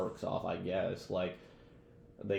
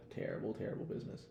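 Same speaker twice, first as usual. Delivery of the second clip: breathy whispered disappointment after a sigh; weary whisper; 11 dB quieter, faster than usual. Real, not acted. Podcaster sighing over a cancelled show, whispered. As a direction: whisper it like a tired mourner.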